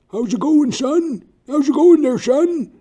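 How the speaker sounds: very high, loud old-man impression; a booming high-pitched old Colonel calling out; the Colonel's voice. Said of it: "That's how he sounds".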